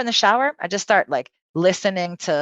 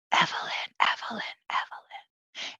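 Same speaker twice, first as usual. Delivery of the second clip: soft, eerie name-calling whisper; whispered inner voice; 10 dB quieter than usual. Retold or imitated. Imitates voices heard in head.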